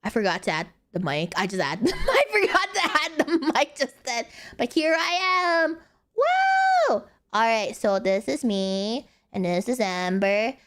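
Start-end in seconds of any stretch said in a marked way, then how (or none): 4.6-7.0 excited cheer. Real, not acted. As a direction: cheer it out, over the top. Vtuber showing off new model.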